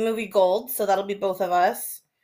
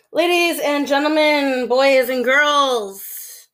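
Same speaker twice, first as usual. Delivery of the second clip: ringmaster; 4 semitones higher, slower than usual. Pretend. Ringmaster-style opening announcement.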